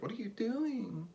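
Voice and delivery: high, slow, whiny tired wife. Retold or imitated. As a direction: whine it like a worn-out wife. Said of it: "the tired wife voice".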